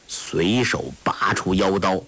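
usual speaking voice

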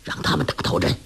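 hushed low aside. A narrator's reading. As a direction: murmur it low and confidential. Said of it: "低声说".

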